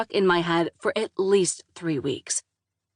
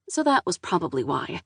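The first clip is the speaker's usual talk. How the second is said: ordinary delivery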